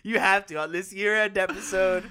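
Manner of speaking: loud, high begging through laughter; laughing plea. Real, not acted. Host begs Steve to try his American accent, laughing plea. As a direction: insist helplessly while cracking up.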